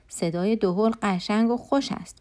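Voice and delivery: ordinary delivery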